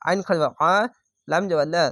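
normal talking voice

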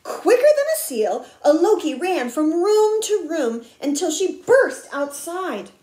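animated picture-book telling; excited story narration. A narrator's reading. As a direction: tell the chase with bursts of emphasis. Reads a picture book aloud.

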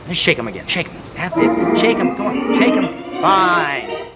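pumped-up fitness coach calling the count over music; exercise count-along. Acted. Coach counting the hand exercise for viewers at home, upbeat and driving. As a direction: drive the class through the reps, energetic.

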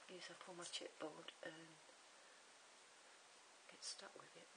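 soft worried whisper; whispered doubt. Real, not acted. Crafter doubting the glue, whispering to herself. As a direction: breathe it to yourself, unsure.

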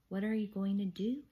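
plain reading voice

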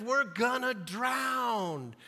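dragged-out mock despair; mock panic. Disciples' imagined script, drowning.

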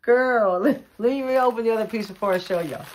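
high, loud burst of excitement; excited outburst. Real, not acted. Woman opening a friend's gift on camera, excited outburst. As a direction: exclaim it loudly, bowled over.

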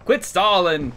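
loud. Real, not acted. Yelling at toilet stall.

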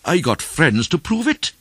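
high, loud indignant outburst; furious. A narrator's reading. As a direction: protest in a rising fury. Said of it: "the stranger said in a fury".

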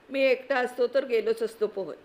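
plain reading voice

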